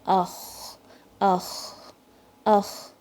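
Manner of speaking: اخ with air flowing, no jolt; gentle raspy ukh; flowing kha. Shown as a demonstration. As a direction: keep اخ soft and continuous. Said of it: "sound فلو ہو رہی ہے".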